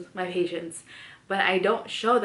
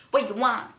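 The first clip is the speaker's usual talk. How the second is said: curt worker; 4 semitones higher, 4 dB louder than usual. Retold or imitated. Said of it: "I'm like".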